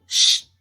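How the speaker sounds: "X" said as a bare hushing "shhh"; long voiceless sh in place of "X"; isolated sh sound. Shown as a demonstration. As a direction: make only the sh sound for "X". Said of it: "elas representam um único som".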